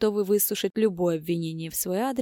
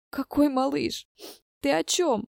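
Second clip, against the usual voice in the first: high-pitched, tearful bewilderment; tearful sob; 8 semitones higher than usual. A narrator's reading. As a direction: ask in shock, on the edge of tears. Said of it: "всхлипнула Катя". (всхлипывая, растерянно)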